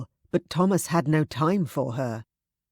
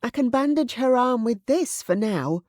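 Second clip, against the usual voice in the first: higher, insistent maid's voice; urgent plea; 7 semitones higher than usual. Acted. Susan insisting they stop the bleeding, urgent. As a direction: push him to act. Susan giving practical plan.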